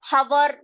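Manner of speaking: हवर with the h voiced, "ha-var"; wrong pronunciation. Shown as a demonstration. Teacher warning pupils off the sounded h, wrong pronunciation. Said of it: "इसको हम हवर नहीं पढ़ेंगे".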